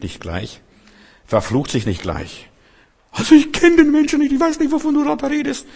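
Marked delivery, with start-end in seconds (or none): 3.1-5.7 much higher, frantic denial; Petrus panicking. Retold or imitated. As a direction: deny it in a panicked, shrill rush. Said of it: "Gleich durchgedreht". (Petrus, panisch hoch und hastig)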